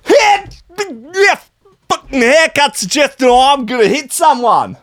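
high, loud caricature Australian punk; hammed-up indignant Australian shout; scathing Aussie rant. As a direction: yell it like an outraged Aussie singer. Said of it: "wrote a scathing message on Facebook".

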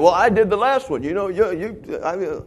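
a disciple claiming he already did his share; mock stammering excuse; defensive disciple. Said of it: "They were too busy going".

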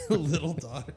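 amused mocking echo, breaking into a laugh. Co-host repeating the creepy prince's line back, laughing.

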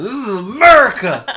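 loud, high bar-room holler, hammed up; mock patriotic scream from atop a bar stool. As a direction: bellow it like an over-the-top American. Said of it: "standing in a bar stool screaming".

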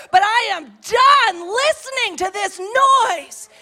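high, loud, shouting down the devil; defiant shout. Acted. Believers rebuking the devil, a loud high defiant shout. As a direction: yell it like a battle cry. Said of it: "we stand up and say".

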